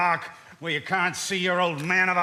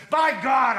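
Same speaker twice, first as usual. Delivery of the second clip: higher and louder oath; furious oath; 7 semitones higher, 6 dB louder than usual. Acted. Husband raging at his own foolishness, furious oath. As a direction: burst out at yourself.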